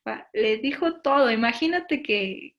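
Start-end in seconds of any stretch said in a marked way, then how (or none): none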